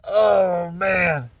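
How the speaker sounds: ordinary talk, nothing marked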